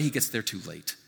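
ordinary delivery

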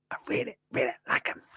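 soft unvoiced whisper; whispered repeat. Shown as a demonstration. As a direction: breathe it as a secret. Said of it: "whispered repeat".

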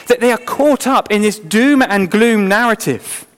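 ordinary talk, nothing marked

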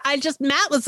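ordinary talk

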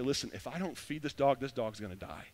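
quiet, sober resolve, sinking at the end; imagined child taking it to heart; earnest ownership. Retold or imitated. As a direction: drop low and soft, sincere. Voices hoped-for sober ownership.

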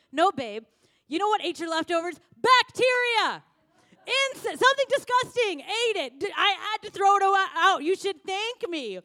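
high, loud teasing reproach; shrill bacteria accusation; playful scolding wife. Retold or imitated. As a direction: scold him with a grin. Wife scolds husband in story.

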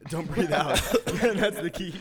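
laughing through the words; giggling while speaking. Real, not acted. Laughing through whiskey advice.

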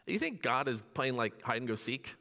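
normal talking voice

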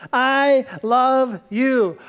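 a slow heartfelt I love you; tender I love you. Shown as a demonstration. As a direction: let each word land gently. Repeats three words tenderly.

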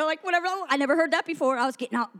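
ordinary talk, nothing marked

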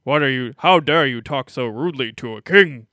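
booming, haughty royal decree; pompous king voice. Retold or imitated. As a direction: bellow like an offended king. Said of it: "Like that".

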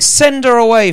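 loud high pressing demand; insistent demand. Acted. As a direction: cry out to be rid of her. Disciples quoted again, raised.

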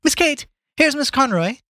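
very high maid's call up the stairs. Acted. Maid to hostess from the foot of the stairs, high call. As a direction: pitch it high to announce a guest. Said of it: "called out".

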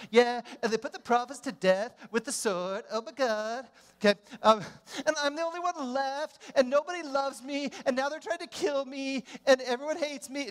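put-on high, whiny valley girl. Elijah whining about everyone hating him, high valley girl. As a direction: whine like a dramatic teen girl. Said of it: "I turned into a valley girl".

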